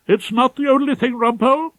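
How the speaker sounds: a nervous pompous senior barrister with urgent news; fussy, pompous head of chambers. Acted. Ballard's voice.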